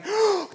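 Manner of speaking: sharp high intake of breath; imitated deep gasp. Preacher acting the swimmer coming up, big mock gasp. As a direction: gasp like someone surfacing for air. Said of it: "takes this deep breath of air".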